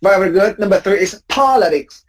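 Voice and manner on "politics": politics stressed on POL, POL-i-tics; stress on first syllable. Shown as a demonstration. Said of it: "Please don't say politics".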